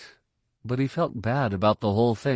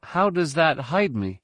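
put-on higher, questioning young pupil; eager pupil; 7 semitones higher than usual. A narrator's reading. Pupil asking mentor about the technique, questioning. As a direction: ask it pitched up, puzzled.

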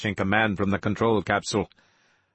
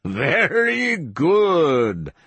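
high, long-drawn gloating cry; stretched-out, high-pitched delight; crowing; 7 semitones higher, 4 dB louder, much slower than usual. A narrator's reading. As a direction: crow it slowly in triumph. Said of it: "Vicentelli crowed".

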